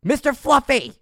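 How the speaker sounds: high, loud summoning yell; yelled call. Retold or imitated. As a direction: yell the name across the barracks. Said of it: "she would yell out".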